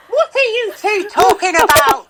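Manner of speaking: put-on a confused old woman who has missed the whole chat. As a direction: play a bewildered pensioner who can't hear. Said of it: "Put your hearing aid on".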